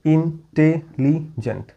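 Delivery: ইন্টেলিজেন্ট said slowly, syllables pulled apart. Shown as a demonstration. Teacher counts the four syllables for students, slowly. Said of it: "টেট্রাসিলেবিক হয়ে যাচ্ছে".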